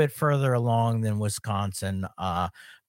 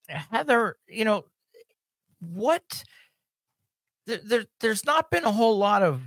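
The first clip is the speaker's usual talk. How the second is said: higher, teasing sarcastic tone; 9 semitones higher than usual. Pretend. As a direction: play it mock-serious, tongue in cheek. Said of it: "no, I'm just being sarcastic".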